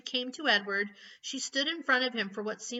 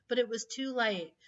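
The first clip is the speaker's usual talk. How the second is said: plain reading voice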